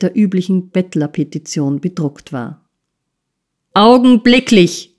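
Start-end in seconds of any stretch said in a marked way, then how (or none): 3.7-4.9 thundered, much higher and louder; thundering. A narrator's reading. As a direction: roar it at the boy. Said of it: "donnerte Squeers". (donnernd, laut und hoch)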